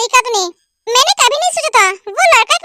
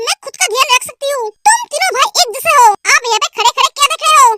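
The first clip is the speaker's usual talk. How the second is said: normal talking voice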